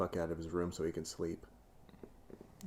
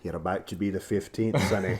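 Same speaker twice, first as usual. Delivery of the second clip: put-on Scottish accent, teasing threat; comic Scottish lady sizing someone up. Scottish lady threatening a lad, mock-menacing Scottish accent. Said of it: "you can do that accent".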